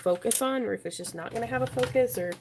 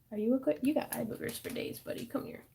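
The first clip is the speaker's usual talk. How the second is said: talking to a dog; 8 dB quieter than usual. Real, not acted. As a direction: coo softly at the dog. Talking baby talk to her dog.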